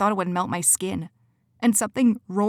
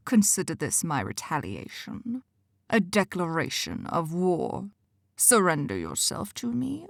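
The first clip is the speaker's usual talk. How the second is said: cold ultimatum; 4 dB quieter than usual. Acted. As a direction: deliver the ultimatum calmly. Villain declares war.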